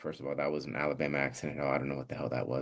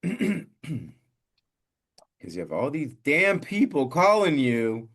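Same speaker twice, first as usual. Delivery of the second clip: high, loud comic exasperation; exasperated rant; 7 semitones higher, 5 dB louder, slower than usual. Real, not acted. Host griping about spam loan calls, exasperated rant. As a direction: drag out the complaint, raised and insistent.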